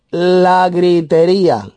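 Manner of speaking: high, drawn-out proclamation; emphatic scripture reading. Real, not acted. Preacher announces the next sin from the verse, high and drawn-out. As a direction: stretch the word out slowly and high.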